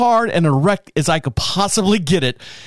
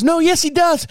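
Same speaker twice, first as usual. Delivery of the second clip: much higher "yes, he does"; high insistent reply; 12 semitones higher than usual. Retold or imitated. Man at the window, high insistent yes. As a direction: squeak it up insistently. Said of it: "And he goes".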